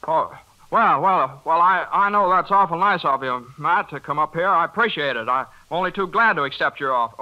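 forced cheerfulness. Acted. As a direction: fake brightness to hide trouble from wife. Covering arrest in front of wife.

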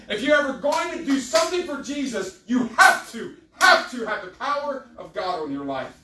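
loud, high, urgent sermon delivery; full-force preaching. Real, not acted. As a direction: press every phrase on the congregation with fervor.

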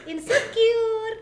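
insecure very slow and high; smiling voice. Shown as a demonstration. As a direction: stretch insecure with a big smile. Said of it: "smile-nya kurang".

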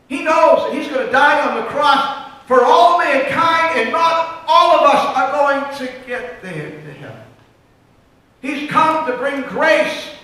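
high, loud preaching climax; preacher pressing the congregation, loud and fervent. Acted. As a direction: drive it home from the pulpit. Preaching at full force.

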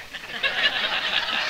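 high, unvoiced laugh; breathy laugh. Real, not acted. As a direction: laugh off the joke. Laughing at the joke.

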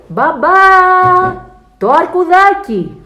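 high, loud, drawn-out call; child shouting. A narrator's reading. Alfons wants his teddy from Dad, shouting. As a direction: holler for Dad, stretching the words. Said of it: "φωνάζει ο Άλφονς".

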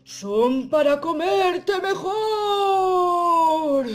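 loud, very slow menacing roar; roaring big bad wolf. Wolf lunging to eat Caperucita, roaring. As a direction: roar it out, stretching every word. Wolf reveals himself and attacks.

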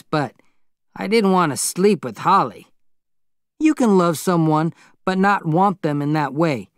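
lightly voiced older man explaining himself; a hint of an earnest older man; Joe, earnest confession. A narrator's reading.

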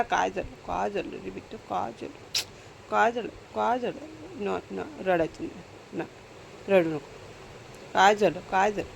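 hushed, tender murmur to a hurt pet; soft soothing coo. Real, not acted. Owner soothing her beaten pet Tiku, soft tender coo. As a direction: comfort him gently, almost under your breath.

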